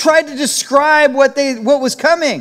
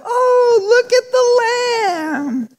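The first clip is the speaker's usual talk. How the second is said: high, slow gushing coo, near tears; 12 semitones higher, much slower than usual. Retold or imitated. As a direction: coo it like spotting something adorable. Stranger gushing over you as lamb.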